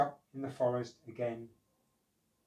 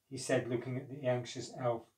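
usual speaking voice

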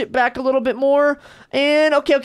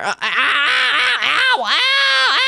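drawn-out yelps of pain, higher; pained yelling; 9 semitones higher, much slower than usual. Real, not acted. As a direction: cry out slowly in pain. Falls down in the game.

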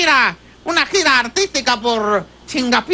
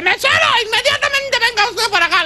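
higher, acted call to a waiter; imperious summons; 6 semitones higher than usual. Toad orders the waiter to come at once, imperious summons. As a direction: summon him across the room.

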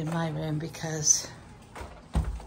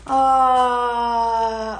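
startled shout; 5 semitones higher, 9 dB louder than usual. Real, not acted. Sudden outburst while packing.

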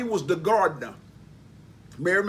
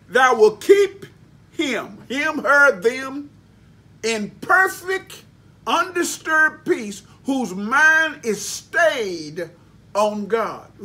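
loud, high, slow, building scripture quote; preaching scripture; 4 semitones higher, 5 dB louder, much slower than usual. Acted. Preacher proclaiming the verse to his congregation, loud and slow. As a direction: lift the verse up slowly, preaching.

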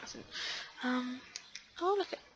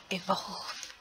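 excited gasp; 4 dB louder than usual. Real, not acted. Gasps at plant evolving.

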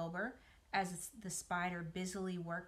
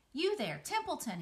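higher, calling out to the rat; 7 semitones higher than usual. Acted. Wilbur calls Templeton from the pen, calling out. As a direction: call across the yard. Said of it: "he called".

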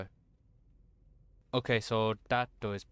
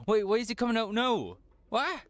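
high startled yelp; 11 semitones higher, 4 dB louder than usual. Real, not acted. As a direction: jump in alarm mid-question. Startled by enemy appearing.

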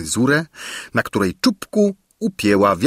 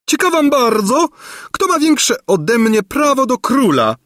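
much higher, louder screeching crone; menacing screech; 4 dB louder than usual. A narrator's reading. Gburia Furia threatening the court, high screech. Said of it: "zaskrzeczała groźnie Gburia Furia".

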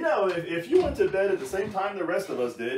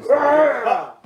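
sudden shout; 9 semitones higher, 12 dB louder than usual. Acted. Story interrupted by loud shout.

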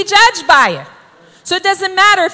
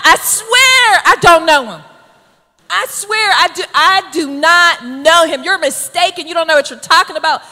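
emphatic denial; 2 semitones higher, 3 dB louder than usual. Acted. Preacher voices Peter denying he knows him, emphatic. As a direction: swear it emphatically, as if cornered. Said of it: "it's more emphatic".

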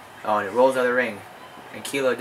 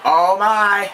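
excited; 8 semitones higher, 9 dB louder than usual. Real, not acted. Shocked commentary reaction.

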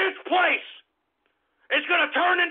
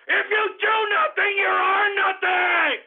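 shouted slogan; 4 semitones higher than usual. Real, not acted. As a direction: bellow it at the listeners. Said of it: "repeats it shouting".

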